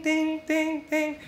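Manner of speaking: high, sung ding sound; imitated ding. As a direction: imitate a notification chime. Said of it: "it starts, like, going ding".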